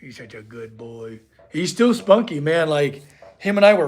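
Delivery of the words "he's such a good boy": much softer, lower murmur to the dog; tender low dog talk; cooing to dog. Real, not acted.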